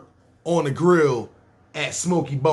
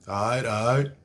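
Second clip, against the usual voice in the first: laid-back nineties dude; 3 semitones lower than usual. Retold or imitated. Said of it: "That's how he talk".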